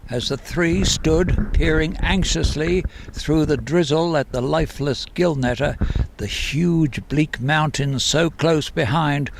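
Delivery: understated storytelling voice; story narration. A narrator's reading. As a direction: read it like an author sharing his own book, steady and grave. Author reads his novel aloud.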